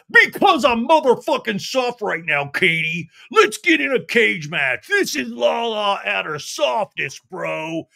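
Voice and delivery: high, loud hammed-up brawler voice; mock Lala tough talk. Host imitating Lala challenging Katie to a cage match, high and loud. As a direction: puff up and threaten. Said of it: "And Lala's like".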